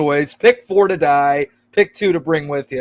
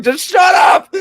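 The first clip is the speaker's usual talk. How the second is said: imitated high, exasperated shout; mock-frustrated holler, pitched way up; frustrated outburst; 13 semitones higher, 3 dB louder than usual. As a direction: yell it like a man at the end of his rope. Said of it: "gets so frustrated at one point".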